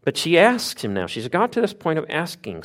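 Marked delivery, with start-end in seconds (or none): none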